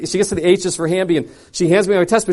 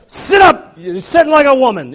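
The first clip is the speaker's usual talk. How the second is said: high loud order; barked command; 6 semitones higher, 8 dB louder than usual. Retold or imitated. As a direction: snap the man upright. Speaker yanks man from chair.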